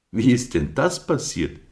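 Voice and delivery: high, bright-eyed little lamb asking. A narrator's reading. Flori fragt aufgeregt.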